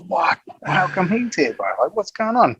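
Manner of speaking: high, bright disbelief; surprised. Retold or imitated. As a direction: act baffled, pitch up. Retelling shock at spotting player.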